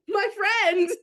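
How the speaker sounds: excited greeting. Retold or imitated. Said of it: "he was like".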